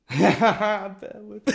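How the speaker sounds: high, slow echo through real laughter; cracking up. Real, not acted. Joke-teller catching the bear pun, cracking up. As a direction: repeat it while bursting out laughing.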